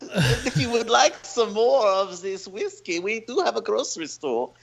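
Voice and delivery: put-on Dietrich, German accent. German bartender directing a customer to the airport store, German accent. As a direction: play a helpful German bartender. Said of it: "[name] has a very good Dieter, Dietrich impersonation".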